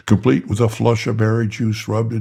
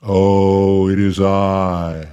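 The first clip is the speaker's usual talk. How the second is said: loud, slow, grand voice from inside; booming angel voice; 2 semitones lower, 6 dB louder, much slower than usual. Acted. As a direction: boom it like a heavenly being. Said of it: "says a voice from inside".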